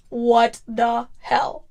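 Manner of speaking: slow, stretched-out exclamation; drawn-out disbelief. Real, not acted. Podcaster reacting to an ASMR Super Bowl ad, drawn-out disbelief. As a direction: draw each word out in disbelief.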